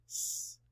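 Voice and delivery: "S-I-l-i-n-t" as soft, separate sounds; sss, eye, lll, eh, nnn, t, with pauses; sounded out. Shown as a demonstration. Teacher maps sounds with class, "S-I-l-i-n-t" segmented. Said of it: "Map the sounds with me".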